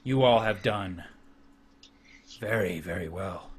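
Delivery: very soft, low, grave warlord; hushed, deep chieftain granting praise; awed orc chieftain. Acted. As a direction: pronounce it solemnly with long pauses. Chieftain admires the prize.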